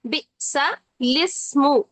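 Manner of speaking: बिसलिस्मु slow, alif silent; careful बिसलिस्मु; Qur'anic recitation. Shown as a demonstration. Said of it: "ये इस condition में पढ़ा जाएगा".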